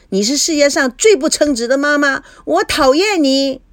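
put-on high, angry child's outburst; child's yelling. A narrator's reading. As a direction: cry out resentment, dragging the end. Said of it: "菲菲喊道". (孩子气愤地尖声喊)

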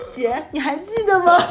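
slow, high and loud, words broken by laughter; laughing hard. Retold or imitated. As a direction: ask it while helpless with laughter. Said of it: "我小姨狂笑".

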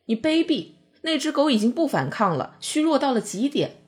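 high, heated accusation; burning anger. A narrator's reading. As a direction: hurl the word at him in fury. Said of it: "我怒火中烧".

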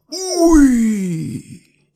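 high uy. Shown as a demonstration. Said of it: "demo of near-miss exclamation".

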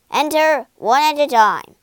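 loud, forceful soldier's command; barked order. A narrator's reading. Soldier on guard to Dorothy at the palace, barked order. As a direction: bark it like a guard on duty. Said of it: "he barked".

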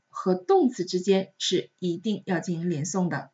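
ordinary talk, nothing marked

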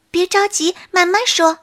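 high, gentle small deer comforting; calm the bear down kindly; soothing. A narrator's reading. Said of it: "小鹿安慰他".

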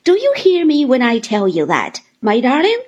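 teasing her darling client; Miss Mowcher. Acted.

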